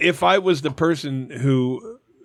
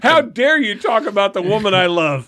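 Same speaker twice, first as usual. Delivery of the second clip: louder, raised-pitch hammed-up indignation; mock outrage; 5 semitones higher, 4 dB louder than usual. Man answering an insult to his lover, mock outrage. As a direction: defend her honour with pretend offence.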